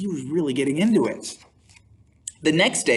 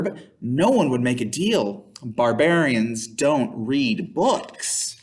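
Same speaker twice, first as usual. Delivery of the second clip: ordinary delivery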